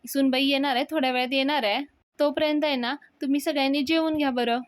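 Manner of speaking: announcing loudly. Retold or imitated. Anna on the mike telling guests to eat, loud and high. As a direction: call out over the mike to everyone.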